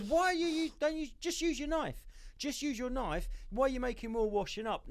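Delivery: half-hearted imitation of the comedian scolding chefs; Uncle Roger impression. Mocking how the comedian scolds chefs, light Uncle Roger accent. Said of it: "So he always goes".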